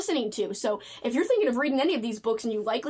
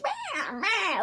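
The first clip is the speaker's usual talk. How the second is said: high whiny mock whimper; hammed-up whiny girl voice; whiny teenage girl; 9 semitones higher than usual. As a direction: mimic a whiny young woman, mock-complaining. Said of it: "voicing her in a whiny voice".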